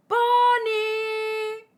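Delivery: long high sung-out call. Acted. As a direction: call her name across the sky. Calling out for lost girl.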